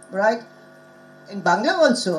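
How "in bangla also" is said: usual speaking voice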